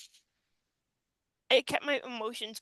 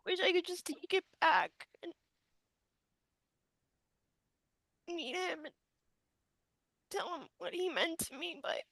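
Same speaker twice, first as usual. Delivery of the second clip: holding back tears; 5 semitones higher than usual. Real, not acted. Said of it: "I'm trying to, like, not cry".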